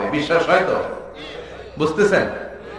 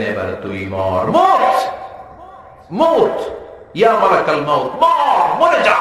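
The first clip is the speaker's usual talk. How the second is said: shouted command. Acted. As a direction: roar the death command. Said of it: "আল্লাহ চিৎকার দিয়ে বলবেন". (চিৎকার করে আদেশ)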